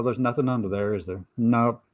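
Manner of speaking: normal talking voice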